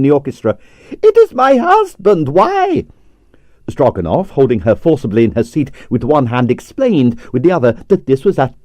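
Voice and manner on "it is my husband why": very high, loud cry of dismay; shrill ballerina. Acted. Ballerina cries out about her husband dancing, very high and shrill. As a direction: shriek like an outraged diva. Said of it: "Arienska's shrill voice".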